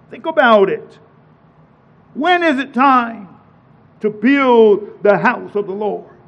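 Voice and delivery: ordinary talk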